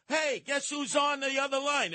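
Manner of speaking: a friend shouting eagerly into the phone; excited call. Retold or imitated. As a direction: call out like Todd bursting with news. Retelling Todd calling excitedly.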